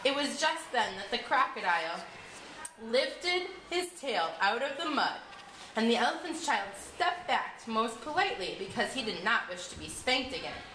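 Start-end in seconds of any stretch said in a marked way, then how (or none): none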